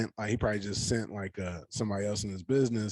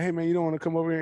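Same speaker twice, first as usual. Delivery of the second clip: coaxing call; 8 semitones higher, 4 dB louder, much faster than usual. Retold or imitated. Coaxing child over.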